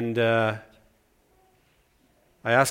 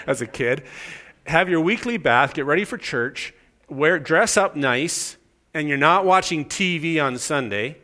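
usual speaking voice

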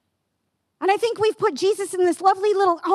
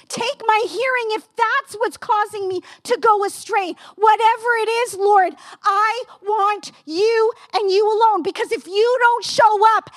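impassioned prayer; 4 semitones higher than usual. Real, not acted. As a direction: pour it out as a desperate surrender to God.

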